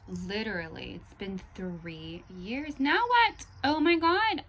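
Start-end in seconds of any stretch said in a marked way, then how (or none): 2.8-4.4 high, loud yell of frustration; exasperated outburst. Real, not acted. Three years on from ayahuasca, exasperated yelling. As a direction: burst out loudly, fed up.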